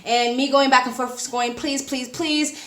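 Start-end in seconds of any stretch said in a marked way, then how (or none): 1.5-2.6 soft begging please, said softer; pleading puppy eyes. Retold or imitated. Traveler begging the airport official, softly pleading. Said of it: "trying to do the most puppy eyes".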